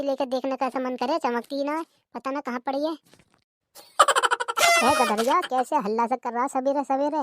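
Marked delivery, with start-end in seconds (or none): 4.0-4.8 high giggle. Acted. Laugh after insult.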